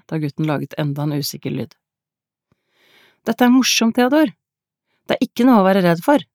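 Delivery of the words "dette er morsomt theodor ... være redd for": put-on high, happy child's call; cheerful calling out. A narrator's reading. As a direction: call out brightly to reassure him, pitched up. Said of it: "ropte Alette og smilte". (roper lyst og muntert)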